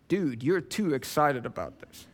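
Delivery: hint of a deadpan colleague; mock coworker. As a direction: lightly voice a put-off coworker. Voices unimpressed coworkers.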